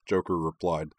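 ordinary delivery

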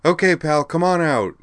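loud, high call upward. A narrator's reading. Uhtred calls loudly up to the hidden man. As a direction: call him out of hiding. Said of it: "she declared in a louder voice".

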